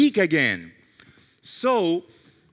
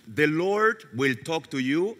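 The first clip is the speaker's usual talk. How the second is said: normal talking voice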